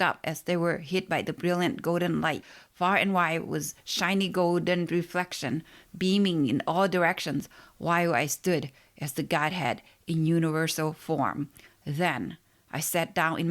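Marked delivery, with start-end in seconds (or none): none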